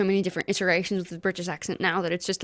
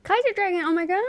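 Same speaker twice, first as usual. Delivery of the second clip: high, thrilled greeting; excited gasp; 10 semitones higher, much faster than usual. Real, not acted. Host greeting the arriving guest, thrilled and high. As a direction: squeal the guest's name in delight.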